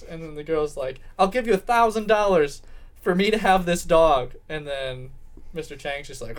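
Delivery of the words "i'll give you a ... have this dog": loud, higher-pitched acted offer; hammed-up final bid, pushed out loud; emphatic bidding. Girl outbids Chang for the dog, emphatic.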